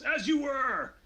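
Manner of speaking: loud, high military order; commanding shout. Acted. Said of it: "Salome shouts".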